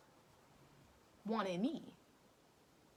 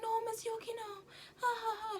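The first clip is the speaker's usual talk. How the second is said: put-on high girl voice; 13 semitones higher than usual. Girl voice crying laugh.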